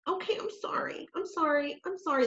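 mock apology. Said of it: "constantly apologizing".

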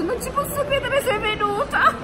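thrilled high-pitched fangirl squeal; amazed disbelief. Retold or imitated. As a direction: squeal it like meeting your idol. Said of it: "Ho detto".

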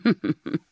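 small tittering laugh to cover a dig; little fake laugh. A narrator's reading. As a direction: titter as if it were a joke. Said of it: "gave a little laugh".

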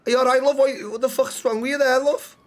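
put-on Liverpool accent, checking on someone; Scouse accent. Passer-by asks injured woman what's wrong, in Scouse accent. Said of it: "Scouse, okay".

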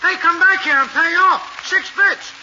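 loud calling after him; angry yell. Acted. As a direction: shout down the street for your fare. Cabby yells after fleeing fare.

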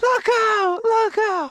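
high shouted warning; high call. Retold or imitated. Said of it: "I said".

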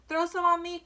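put-on loud, high boyish cry; eager storybook yell; cried out. A narrator's reading. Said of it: "cried Avery".

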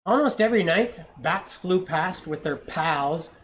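gentle read-aloud storytelling; story narration. A narrator's reading. Parent reading a picture book to a child, storytelling voice.

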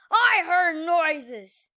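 loud slow grumpy shout. Acted. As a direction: bark it out. Quinn shouting at door.

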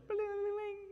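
high sing-song ring; phone ringtone imitation. Imitating the phone interrupting a couple's fight, high chime.

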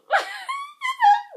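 very high shrieking laugh; delighted squeal-laugh. Real, not acted. Fan shrieking with delight at the cute photo. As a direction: let out a thrilled squeal.

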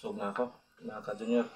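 chewing while talking; mouth full. Real, not acted. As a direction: hand near mouth. Seen 1: Left man has spoon at mouth, chews, hand near mouth.

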